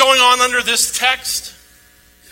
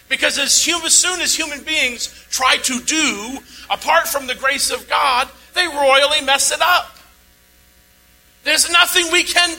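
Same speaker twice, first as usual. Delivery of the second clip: preaching; 4 semitones higher than usual. Acted. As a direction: press the message home from the pulpit. Preaching the main point.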